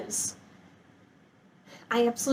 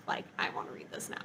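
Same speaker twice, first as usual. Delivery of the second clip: whispered eagerness; 14 dB quieter, faster than usual. Real, not acted. Eager about next book.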